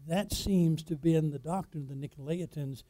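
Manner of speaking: ordinary delivery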